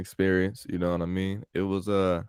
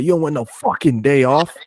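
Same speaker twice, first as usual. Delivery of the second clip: gruff high yell at the nephew, louder; imitating angry boss; 7 semitones higher, 8 dB louder than usual. Pizza boss yelling at his nephew, mock loud yell. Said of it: "he said that just like that".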